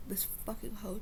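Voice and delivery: ordinary talk, nothing marked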